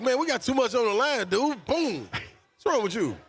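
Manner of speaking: high, exasperated coach telling off a player; mock scolding. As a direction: imitate a coach's disbelieving rebuke. Acted coach scolding his player.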